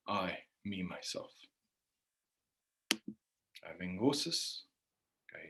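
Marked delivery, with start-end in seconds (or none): none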